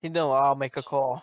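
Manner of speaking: put-on Vietnamese accent. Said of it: "how does the Vietnamese dude with a thick accent sound".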